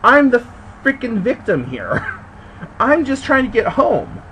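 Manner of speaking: yelling at the clerk, sick of her attitude; exasperated outburst. Retold or imitated. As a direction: throw the frustration back at her. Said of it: "and I'm like".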